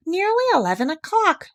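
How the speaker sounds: bright, high and pleased; brightly announcing the time; happily. Acted. Pooh greeting Piglet at breakfast time, happy and high. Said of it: "said Pooh happily".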